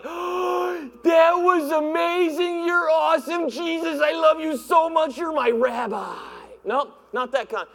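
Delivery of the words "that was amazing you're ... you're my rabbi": higher, very slow gushing awe; gushing amazement. Retold or imitated. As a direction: swoon over him like a starstruck fan. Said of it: "not amazed like".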